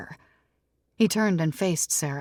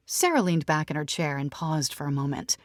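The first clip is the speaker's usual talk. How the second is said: plain reading voice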